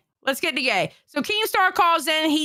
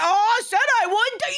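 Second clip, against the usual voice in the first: mocking quote; 7 semitones higher than usual. Mockingly quotes Albert back at him.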